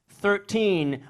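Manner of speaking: "thirteen" with an F for the TH, high and loud; English F-sound thirteen. Retold or imitated. Comic imitating English friends saying "thirteen", mock F-for-TH pronunciation. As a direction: say "thirteen" starting on an F, pushed up high. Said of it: "they pronounce it with, like, an F sound".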